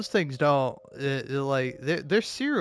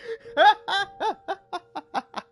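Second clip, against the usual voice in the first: shrieking laugh, much higher; high burst of laughter; 4 dB louder than usual. Real, not acted. Streamer cracking up at his own joke, high laugh.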